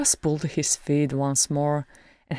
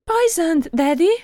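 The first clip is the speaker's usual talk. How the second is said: put-on very high, loud little boy voice; gasping child; 14 semitones higher, 7 dB louder than usual. A narrator's reading. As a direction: gasp it like a shocked small boy. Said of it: "gasped one of his male children".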